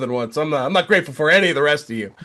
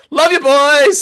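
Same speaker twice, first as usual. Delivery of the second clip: high, loud happy farewell; imitating a friend's cheerful goodbye; cheery sign-off; 10 semitones higher, 7 dB louder, slower than usual. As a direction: toss out a bright love you boys. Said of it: "he'll be like".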